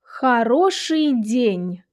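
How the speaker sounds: slow, drawn-out phrase for learners to repeat; measured language-teacher demonstration; slow model reading. Shown as a demonstration. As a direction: read it slowly, syllable by syllable. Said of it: "shadow what I'm saying".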